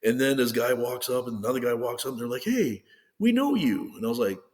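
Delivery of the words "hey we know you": high, drawn-out imitated greeting; excited recognition. Two guys walk up and recognize him, excitedly retold. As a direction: imitate strangers hailing someone they recognize.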